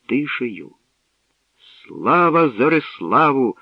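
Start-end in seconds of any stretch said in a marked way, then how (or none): 1.9-3.6 slow, loud cheer of praise; joyful cheering. A narrator's reading. Crowd on the square hails the winner, loud slow cheer. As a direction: hail the hero like a crowd. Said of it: "гримів майдан радісними вітаннями".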